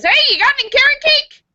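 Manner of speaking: high, eager rabbit asking; high little rabbit voice asking the baker. Acted. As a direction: pipe up like a cheeky rabbit.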